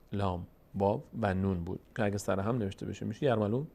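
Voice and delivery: ordinary talk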